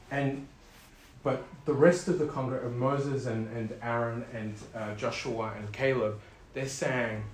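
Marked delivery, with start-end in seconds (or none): none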